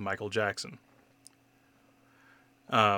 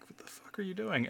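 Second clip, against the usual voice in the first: high, incredulous mock whisper; imitated stunned whisper; whispered disbelief; 10 semitones higher, 6 dB quieter than usual. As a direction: hiss it softly like a baffled classmate. Classmates' stunned reaction, acted.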